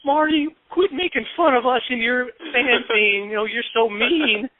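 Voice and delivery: fake-cry it, drawn out and high-pitched; mock crying. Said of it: "she acted like she was crying".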